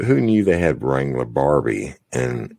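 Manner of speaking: ordinary talk, nothing marked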